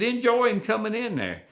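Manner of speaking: retold coaxing. Calming the old hunter, retold.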